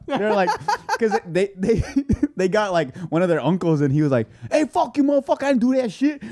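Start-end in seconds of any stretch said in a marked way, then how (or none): 4.5-6.2 mock angry uncle. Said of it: "he went off on them".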